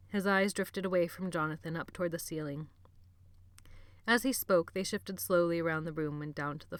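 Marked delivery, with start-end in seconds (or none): none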